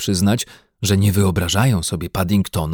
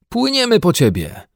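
calling out; 13 semitones higher than usual. A narrator's reading. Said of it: "wtórowała mu Judyta".

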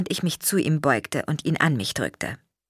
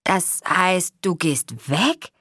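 worried grandma; 3 semitones higher, much slower than usual. A narrator's reading. Grandma asks, hurt.

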